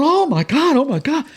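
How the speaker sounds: very high, amazed cameraman impression; acted-out high-pitched astonishment; excited exclamation. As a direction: imitate someone losing it over a fish. Cameraman exclaims at jumping fish.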